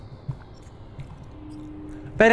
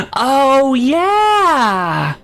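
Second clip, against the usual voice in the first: drawn-out, raised mock cheer; hammy exclamation; 4 semitones higher than usual. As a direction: stretch it out with big relish. Hammy exclamation answering read text.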